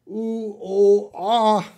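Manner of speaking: high, separated "oo, oh, ah"; three-vowel demo. Shown as a demonstration. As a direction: list the vowels "oo, oh, ah" apart for learners. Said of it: "this O has a oo sound".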